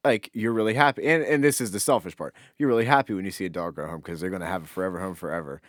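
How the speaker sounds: normal talking voice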